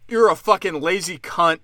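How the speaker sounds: ordinary delivery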